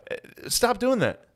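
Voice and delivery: mock scolding. Real, not acted. Teasing rebuke.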